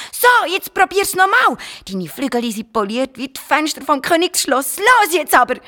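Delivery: high, loud rallying cry; calling out. Acted. Garnelli on the dragon's back calls him on, high and loud. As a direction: urge him on from his back.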